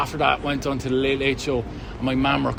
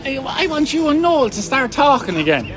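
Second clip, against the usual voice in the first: higher-pitched Irish mum voice; put-on mammy voice, much higher; mum's reply; 10 semitones higher, 3 dB louder than usual. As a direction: answer as an Irish mother, pitched well up. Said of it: "Liam rings her and says".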